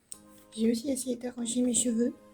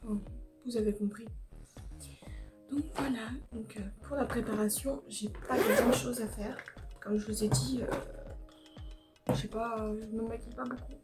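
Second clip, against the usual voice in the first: quiet; 8 dB quieter than usual. Real, not acted. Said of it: "J'évite de parler trop fort".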